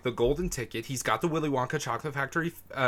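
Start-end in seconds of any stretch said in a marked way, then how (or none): 0.8-2.5 rushed, getting carried away; fast, excited. Real, not acted. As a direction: rattle it off excitedly. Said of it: "you said that so fast".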